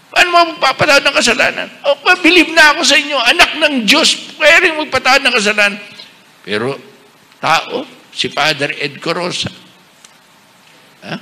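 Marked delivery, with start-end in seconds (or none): 0.1-5.8 a touch of a high, quick doubter; mock skeptic. Bishop imitating a doubter questioning forgiveness, lightly mocking. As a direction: rattle off the doubter's argument.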